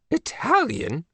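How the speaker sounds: high excited cry of Italian?; Italian? cried out high in surprise. Acted. The Queen hears the passport is Italian, crying out. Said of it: "cried the Queen".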